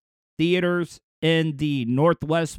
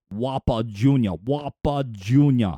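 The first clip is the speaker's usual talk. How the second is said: deep, slowed, a hint of Austrian Schwarzenegger accent; half-hearted Arnold Schwarzenegger accent, lower; accidental Austrian Schwarzenegger ring; Schwarzenegger-ish; 5 semitones lower than usual. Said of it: "almost sounded a little bit like Arnold Schwarzenegger there".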